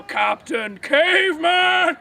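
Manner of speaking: long, drawn-out cartoon battle cry; yell. Shown as a demonstration. Host showing a volunteer the yell, drawn-out holler. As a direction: holler it out, stretching the last word. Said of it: "And yell".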